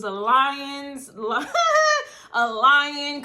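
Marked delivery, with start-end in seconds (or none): 1.5-2.3 sudden high, loud laugh; high burst of laughter. Real, not acted. Breaks into laughter while cursing out a liar, high and loud. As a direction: crack up mid-insult.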